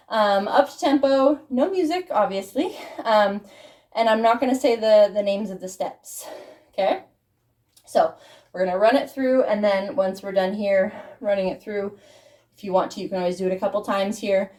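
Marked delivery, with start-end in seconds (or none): none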